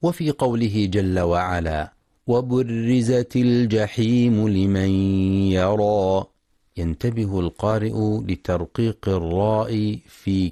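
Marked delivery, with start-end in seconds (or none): 2.3-6.2 slow, drawn-out tajweed chanting; measured melodic Quran recitation. Shown as a demonstration. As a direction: recite with full ghunna and long vowels. Recited verse.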